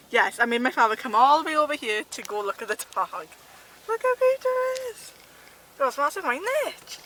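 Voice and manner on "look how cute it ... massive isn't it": high, slow cooing. Real, not acted. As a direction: coo over the cute dog. Cooing at a dog.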